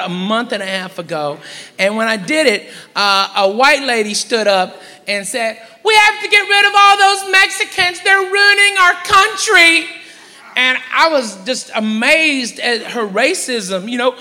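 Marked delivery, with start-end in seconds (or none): 5.8-9.9 high, strident imitation of an outraged woman; mock indignant woman's tirade. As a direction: proclaim it high, stretching out the end. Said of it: "outbursts like that".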